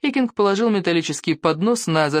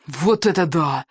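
breathless babble; out of breath; slower than usual. A narrator's reading. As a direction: gasp the words out, winded. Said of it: "задыхаясь, пролепетала Камикадзе". (задыхаясь, лепеча)

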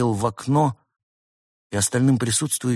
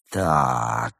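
low, stretched-out word; long, lowered, weighing it up; drawn out; 6 semitones lower than usual. A narrator's reading. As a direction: draw the word out ominously low. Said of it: "протянул Артур".

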